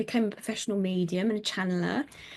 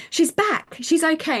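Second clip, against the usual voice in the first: relieved call; 8 semitones higher, 6 dB louder than usual. Retold or imitated. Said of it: "She goes".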